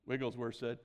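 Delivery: normal talking voice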